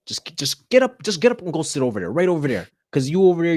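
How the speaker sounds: loud, high order to move seats; retold scolding. Teacher ordering a noisy student to move, loud and sharp. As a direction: bark the student to the back.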